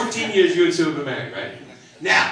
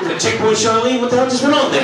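higher, louder disbelief; exasperated; 7 semitones higher, 3 dB louder than usual. Retold or imitated. Husband grumbling about his wife's checkpoint in mock outrage. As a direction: throw up your hands in mock outrage.

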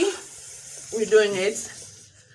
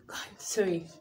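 whisper; 2 semitones lower, 14 dB quieter than usual. Real, not acted. Coughing from chili.

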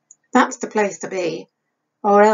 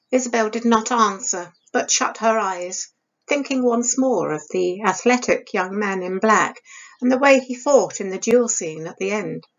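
ordinary delivery

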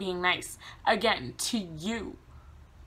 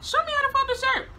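insistent retort; 4 dB louder, faster than usual. Retold or imitated. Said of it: "And I'm like".

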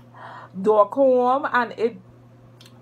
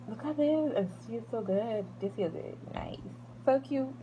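soft admiring; 12 dB quieter than usual. Real, not acted. Fondling camera softly.

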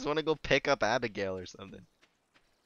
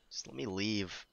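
ordinary talk, nothing marked

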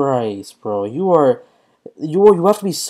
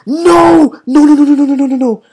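high, loud stream of noes; frantic yelled protest; panicked shouting; 11 semitones higher, 9 dB louder than usual. Real, not acted. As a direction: cry out in alarm, rattling it off. Panics as the attack lands.

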